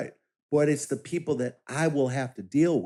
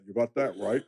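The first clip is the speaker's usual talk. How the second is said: Barack Obama impression; 3 semitones lower than usual. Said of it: "that's exactly how he said".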